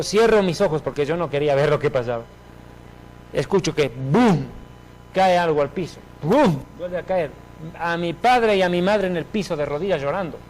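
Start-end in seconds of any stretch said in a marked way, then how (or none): 3.9-4.4 drawn-out spoken boom; imitated thud. Imitates a body falling. 6.2-6.6 sudden loud spoken boom; imitated thud. Imitates the second fall.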